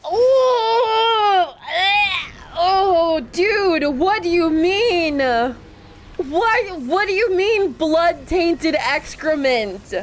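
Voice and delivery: high, loud disgusted cries; grossed-out yelling. Real, not acted. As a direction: shriek in shocked disgust. Reacts to a gross item.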